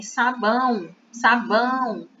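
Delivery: sabão with a stronger A; stress on ão. Shown as a demonstration. Teacher shows the strong vowel in sabão. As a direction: press the A in sabão. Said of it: "a gente escuta com mais força".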